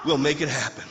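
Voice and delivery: loud, high, building sermon climax; impassioned preacher's shout; preaching at full force. Real, not acted. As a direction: drive it home to the congregation, shouting the key words.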